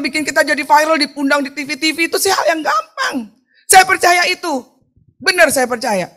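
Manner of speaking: high, loud revival preaching with laughter; fervent preaching. Acted. Preacher rousing the congregation about easy blessings, fervent and loud. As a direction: preach it big, lifting the crowd.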